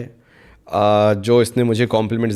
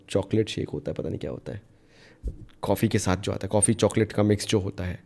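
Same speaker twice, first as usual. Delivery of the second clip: much softer and lower, thinking aloud; muttering to self; 4 semitones lower, 10 dB quieter, faster than usual. Real, not acted. Vlogger trying to recall a coffee drink's name, muttering to self. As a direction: drop to a low murmur while searching your memory.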